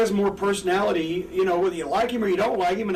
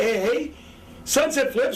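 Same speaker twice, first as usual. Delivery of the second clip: ordinary delivery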